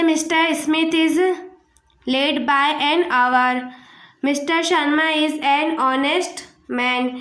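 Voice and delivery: very slow, words spaced out; slow dictation. Shown as a demonstration. Teacher to students, dictating the example sentences slowly.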